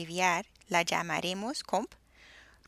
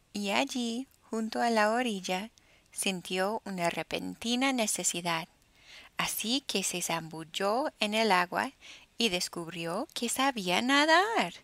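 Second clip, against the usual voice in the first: usual speaking voice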